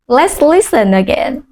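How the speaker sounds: normal talking voice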